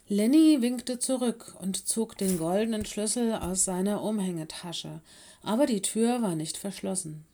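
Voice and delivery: usual speaking voice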